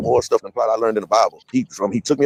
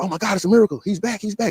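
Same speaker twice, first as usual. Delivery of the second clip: retold excited cry, pitched higher; nurses hollering; 11 semitones higher than usual. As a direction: relay the nurses' amazed mild shout. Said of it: "I heard the nurses hollering".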